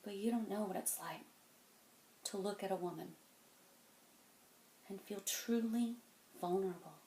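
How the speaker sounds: very soft, with lingering pauses; hushed vulnerability. Acted. Telling a kid about looking at a woman, hushed. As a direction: confide it quietly, letting each phrase hang. Confiding turn in monologue.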